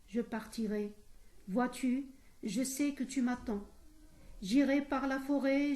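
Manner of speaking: declaimed verse, strong opening then steadier; poem recitation. Shown as a demonstration. Teacher reciting Victor Hugo as an example, poem recitation. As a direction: recite line by line in measured phrases.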